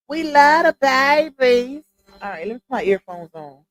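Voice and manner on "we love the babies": slow, drawn-out lightly done Martin impression; a hint of Martin, slow and higher. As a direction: stretch it out like Martin from the sitcom. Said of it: "in my Martin voice".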